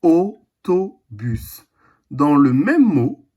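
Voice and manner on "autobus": autobus stretched very slowly; slow teaching word. Shown as a demonstration. A teacher repeating autobus slowly for the class. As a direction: draw autobus out syllable by syllable. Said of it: "il s'écrit juste avec la lettre O".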